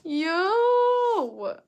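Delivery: high, loud, drawn-out cry; astonished exclamation. Real, not acted. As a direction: exclaim in loud disbelief at the chat. Exclaiming at a chat question.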